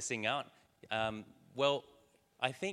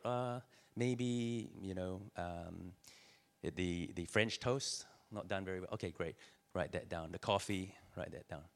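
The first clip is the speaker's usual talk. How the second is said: muttered brainstorm; 7 dB quieter than usual. Acted. Pig and chicken brainstorming quietly.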